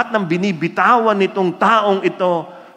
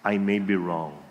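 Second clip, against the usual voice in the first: humble quiet line; 8 semitones lower, 7 dB quieter than usual. Shown as a demonstration. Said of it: "is to say these words".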